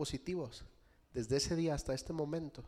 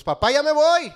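much higher, loud call from the door; son leaving; 12 semitones higher, 17 dB louder than usual. Retold or imitated. Son calls to his father upstairs, high and loud. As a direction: call out like a kid heading out. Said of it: "A los dos minutos".

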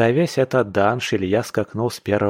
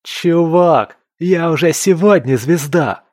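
high, loud burst of elation; thrilled, raised voice; excited exclamation; 8 semitones higher, 6 dB louder than usual. A narrator's reading. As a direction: exclaim it proudly to a friend. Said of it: "выдохнул Илья".

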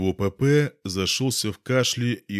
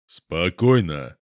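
calm; 3 semitones lower, 3 dB louder, much slower than usual. Acted. Calming a tense rival.

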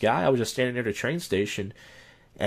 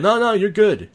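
high, loud mock reassurance; quick waving-off; 12 semitones higher, 9 dB louder than usual. Man with trash can brushing off a question, high loud waving-off. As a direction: imitate someone loudly waving it off.

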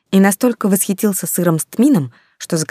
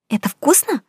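put-on high, eager child asking; bright curious little girl; 9 semitones higher than usual.

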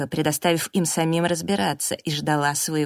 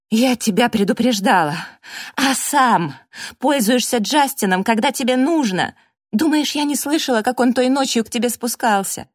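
loud, high, relentless accusation; furious tirade; 6 semitones higher, 6 dB louder than usual. A narrator's reading. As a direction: rage at a hypocrite. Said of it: "со злостью".

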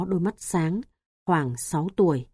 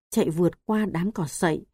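ordinary delivery, nothing marked

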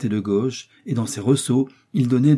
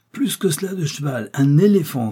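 higher, delighted boast; gleeful exclamation; 6 semitones higher, 4 dB louder than usual. A narrator's reading. Joey marvels at travelling by elephant, gleeful exclamation. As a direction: exult over the grand new mount. Joey exults over new mount.

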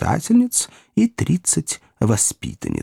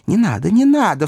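anxious cry; 9 semitones higher, 4 dB louder than usual. A narrator's reading. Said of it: "вскричал мистер Пиквик".

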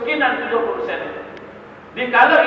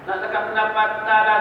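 normal talking voice

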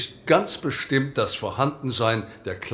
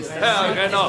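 ordinary talk, nothing marked

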